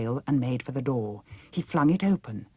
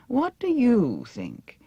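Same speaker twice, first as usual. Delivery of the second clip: higher, slow, grand and loud; haughty princess; 10 semitones higher, 5 dB louder, slower than usual. A narrator's reading. Vain princess ordering Dorothy to follow, high and slow. Mombi asking vainly.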